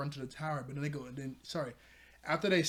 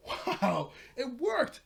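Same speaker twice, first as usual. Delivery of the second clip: high, raised mock astonishment; retold amazement; 10 semitones higher, 4 dB louder, much slower than usual. Friends see it is yesterday, in high-pitched acted amazement. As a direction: act out delighted disbelief, voice leaping up.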